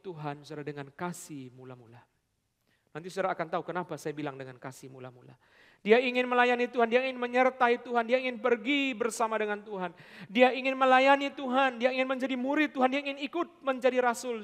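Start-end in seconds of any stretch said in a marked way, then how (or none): 5.8-14.4 loud, high, fervent preaching; preaching at full force. Acted. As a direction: build the sermon to a fiery peak. Preaching climax.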